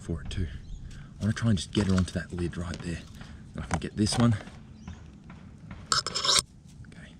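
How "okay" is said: hushed whisper so the spider isn't startled. Real, not acted. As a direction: whisper it, creeping up carefully. Sneaking up on the spider.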